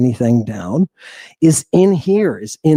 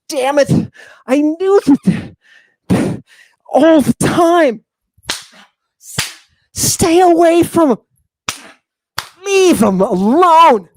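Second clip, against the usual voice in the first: much higher pleading voice of Meat Man; high outraged Meat Man; 13 semitones higher than usual. Acted. Preacher voicing Meat Man, high and outraged. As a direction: shriek at them to leave the boy alone.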